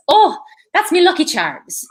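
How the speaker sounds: high, loud, hammed-up Irish accent; exaggerated mock Irish exclamation; bad Irish accent. Shown as a demonstration. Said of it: "This is not a good Irish accent".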